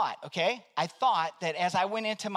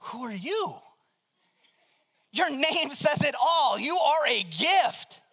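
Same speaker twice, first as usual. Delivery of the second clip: slow, high, louder amazement; amazed wonder; 5 semitones higher, 4 dB louder, slower than usual. Retold or imitated. Pastor praising the kind agent Gifty, slow amazed wonder. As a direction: marvel at her kindness.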